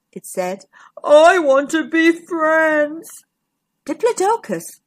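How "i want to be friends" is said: slow, plaintive giant dinosaur; woeful big-creature voice; sad giant voice. A narrator's reading. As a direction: stretch it out mournfully, pleading. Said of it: "sad voice".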